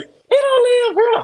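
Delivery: very high, loud, drawn-out woman's voice; mock a woman turning someone away; hammed-up high, slow refusal; imitating a woman. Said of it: "she be like".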